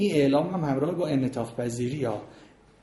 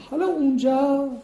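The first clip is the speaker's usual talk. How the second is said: حالا jumps very high and louder, like calling attention; high announcing start; 12 semitones higher, 5 dB louder than usual. Shown as a demonstration. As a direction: lift حالا up sharply to open the moment. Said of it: "مثلاً میگین". (حالا با صدای خیلی زیر و بلند)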